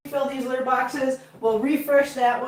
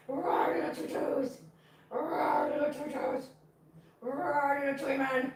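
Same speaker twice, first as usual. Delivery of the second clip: soft, drawn-out pretend roar; playful mock roar; 7 dB quieter, slower than usual. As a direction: growl softly in play at a kitten. Playfully roaring at a kitten.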